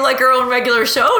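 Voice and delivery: ordinary delivery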